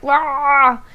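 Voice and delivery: short mock crying wail; a cartoonish wah of grief, not real crying. Retelling her dog's death to the listener with a mock wailing wah. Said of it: "and I was just like".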